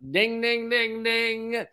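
high, held ringing dings; imitated bell. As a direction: chime it like an alarm bell.

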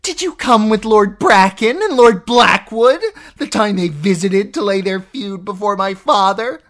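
a sister reliving her jealousy; obsessed, raving aunt. Acted. Lysa's jealous memory rant.

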